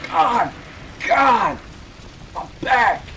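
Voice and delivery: loud, high pained yells; crying out in pain. Acted. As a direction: yell out from a hurt back. Crying out after being hurt.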